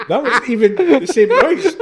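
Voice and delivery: high, loud laughing; shrieking with laughter. Real, not acted. Laughing at the scream.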